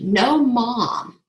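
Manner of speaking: usual speaking voice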